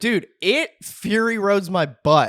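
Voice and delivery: emphatic. Real, not acted.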